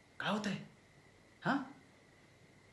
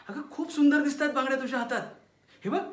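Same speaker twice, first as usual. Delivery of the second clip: fast, bright, over-eager praise, a little louder; forced cheer; 6 semitones higher, 3 dB louder, faster than usual. Acted. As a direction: rush the compliment with fake enthusiasm. (घाईघाईने, खोट्या उत्साहात कौतुक)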